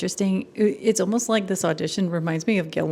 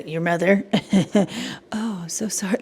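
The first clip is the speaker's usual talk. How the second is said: mock sudden sympathy. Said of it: "she switched her voice".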